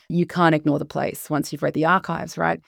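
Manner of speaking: usual speaking voice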